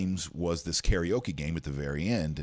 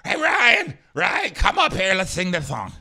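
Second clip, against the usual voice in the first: put-on much higher and louder, squealing with drunken glee; drunk excited shout; 13 semitones higher, 9 dB louder than usual. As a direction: yell across the room for your friend. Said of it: "and she goes".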